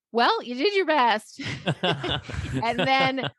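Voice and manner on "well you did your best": put-on high, slow, sing-song kid voice; child consoling. Youngest son to his quitting mom, child consoling. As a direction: imitate a little kid, pitched well up.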